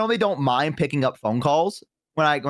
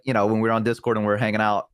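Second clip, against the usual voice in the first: usual speaking voice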